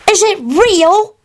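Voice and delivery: put-on slow, high little-kid question; awed child. A narrator's reading. Teddy asks Kingsley about the dragon, awed. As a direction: ask it high and drawn out in wonder.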